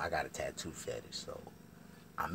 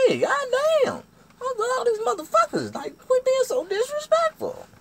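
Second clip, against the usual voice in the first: very high, loud squawk of outrage; hammed-up screaming complaint; yelled protest; 12 dB louder than usual. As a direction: holler back over her cussing. Acted shout back in quarrel.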